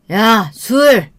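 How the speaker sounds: loud, drawn-out demand for a drink; domineering shout; curt father's command. Acted. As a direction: order it rudely, high and loud. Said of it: "반말로 뭐를 시키는 건데".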